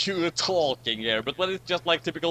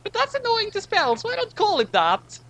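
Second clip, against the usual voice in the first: much higher, squeaky put-on voice; mocking the author; 4 dB louder than usual. Friend reads the author's note in a mocking high voice. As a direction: mimic the author's aside, teasing. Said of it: "that's an author's note".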